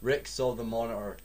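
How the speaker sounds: plain reading voice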